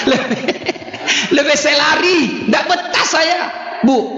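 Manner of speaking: laughing shout. Pretend. Jinn fleeing the nagging wife, laughing shout. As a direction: blurt it out while laughing.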